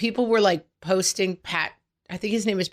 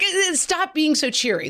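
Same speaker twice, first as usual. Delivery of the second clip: high, exasperated mock protest; 9 semitones higher than usual. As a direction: pretend to snap back at a too-cheery husband. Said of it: "I'm like".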